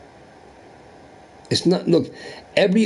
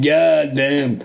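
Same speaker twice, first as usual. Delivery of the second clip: ordinary delivery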